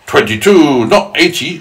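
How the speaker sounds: loud, high indignant earthworm; mild shriek. Acted. Earthworm correcting the centipede, mildly shrieking. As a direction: protest the number loudly. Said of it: "shrieked the earthworm".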